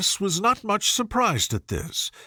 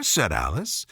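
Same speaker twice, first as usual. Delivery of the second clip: plain reading voice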